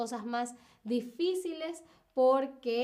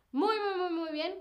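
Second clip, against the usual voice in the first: excited praise; 6 semitones higher than usual. Real, not acted. Praising chat answers.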